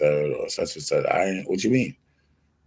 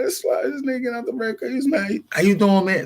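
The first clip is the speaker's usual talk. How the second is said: much higher, fast, cartoonish squeak; mocking high voice. Imitating his friend spotting the guy by the red car, squeaky and fast. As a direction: pitch it way up like a squeaky mock of the friend.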